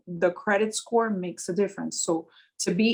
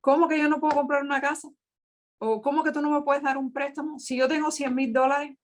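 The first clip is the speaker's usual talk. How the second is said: imitating an upset client, higher-pitched; 6 semitones higher, faster than usual. Client demanding a loan in Spanish, mildly upset. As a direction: voice an indignant customer in Spanish, pitched up. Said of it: "He was upset".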